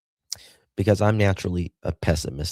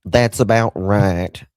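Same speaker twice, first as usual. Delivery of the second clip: slow, loud, emphatic that's about right; hammy, louder agreement with the chat; loud drawn agreement; 3 semitones higher, 8 dB louder, slower than usual. Real, not acted. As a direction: lean hard into agreeing.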